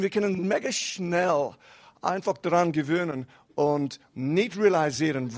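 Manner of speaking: normal talking voice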